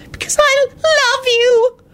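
very high, drawn-out whine; tearful high-pitched plea; whining. Acted. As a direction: wail it pleadingly, slowly. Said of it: "Loretta whined".

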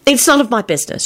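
put-on high-pitched, mildly firm girl. A narrator's reading. Elizabeth backing off her question, mildly firm. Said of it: "she told him firmly".

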